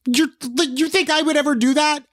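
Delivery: very high, indignant challenge; defensive. Real, not acted. Guest challenging co-host's doubt about her, defensive. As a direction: throw it back defensively, pitch way up. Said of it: "I'm getting so defensive".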